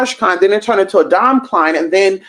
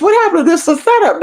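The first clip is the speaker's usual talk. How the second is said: high, startled squeal of suspicion; alarmed realization; 10 semitones higher than usual. Retold or imitated. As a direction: voice jumps up in panicked disbelief. Repeating the paranoid thought.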